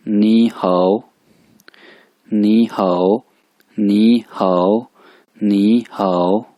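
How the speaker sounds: "नी हाओ" said slowly and clearly, syllable by syllable; slow, careful teacher model of "नी हाओ"; Chinese greeting demo. Shown as a demonstration. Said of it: "तो वो कहते हैं". (धीरे धीरे साफ़ चीनी उच्चारण)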